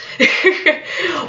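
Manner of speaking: short high-pitched giggle; light laugh. Real, not acted. Vlogger joking about a scourge on your existence, light laugh. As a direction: let out a quick, high laugh.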